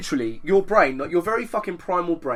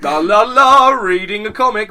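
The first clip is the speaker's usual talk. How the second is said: higher, louder breezy la la la; mock carefree singsong; 4 semitones higher, 10 dB louder, slower than usual. Sitting on the toilet reading a comic, carefree singsong. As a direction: hum along carelessly. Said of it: "I'm like".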